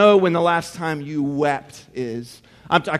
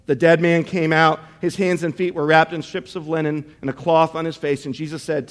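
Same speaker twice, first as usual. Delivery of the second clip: ordinary talk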